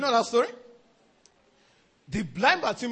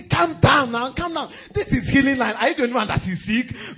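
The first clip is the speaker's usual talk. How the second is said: hushing crowd; 5 semitones higher, 6 dB louder than usual. Retold or imitated. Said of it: "They say".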